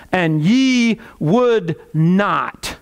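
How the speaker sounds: very slow, weighted words; emphatic scripture quote. Acted. Preacher quoting Jesus's lament to the congregation, emphatic and slow. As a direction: draw out each word with weight.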